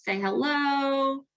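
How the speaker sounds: usual speaking voice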